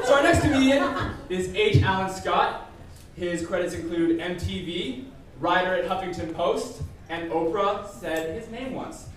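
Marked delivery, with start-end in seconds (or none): none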